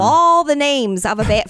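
usual speaking voice

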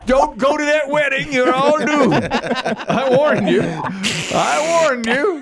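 ominous doom prophet, loud and slow; slow ominous raspy drawl. Acted. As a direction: warn them like a crazed doomsayer. Doom prophet warning wedding guests.

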